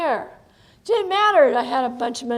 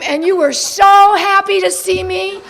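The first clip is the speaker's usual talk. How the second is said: ordinary talk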